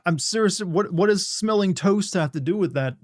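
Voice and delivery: high, insistent, confused question; baffled insistence. Real, not acted. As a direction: press for an answer, pitched high. Still baffled, insisting on an answer.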